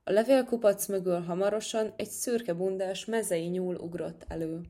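plain reading voice

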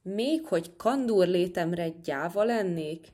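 usual speaking voice

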